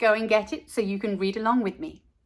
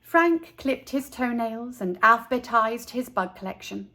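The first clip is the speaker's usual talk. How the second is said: plain reading voice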